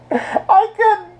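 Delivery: laughing so hard the voice squeaks. Real, not acted. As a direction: say it through giggles. Laughing at the octopus scene.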